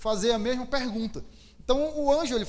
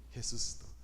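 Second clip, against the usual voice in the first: awed whisper, much softer and lower; 10 semitones lower, 13 dB quieter than usual. Acted. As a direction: breathe the word like a secret revelation. Revelação do túmulo vazio. (sussurro maravilhado)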